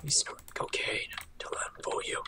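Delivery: hushed, joking whisper; very soft, teasing whisper; whispered aside. Pretend. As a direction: whisper it like a secret accusation.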